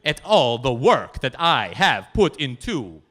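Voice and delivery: put-on very slow, loud Polish-accented rebuke; indignant Polish lady. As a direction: scold him grandly, dragging it out. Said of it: "and she says".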